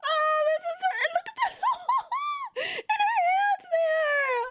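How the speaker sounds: very high, slow squealing through laughs; high-pitched squeal. Real, not acted. Streamer squeals at a painted frog photo, laughing. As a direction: squeal with delight at the cute frog. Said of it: "high-pitched voice".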